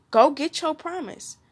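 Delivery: usual speaking voice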